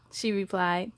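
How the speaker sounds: usual speaking voice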